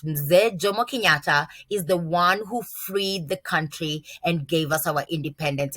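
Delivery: ordinary delivery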